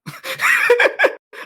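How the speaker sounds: high, breathy shrieking laugh. Real, not acted. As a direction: burst into a wild high laugh.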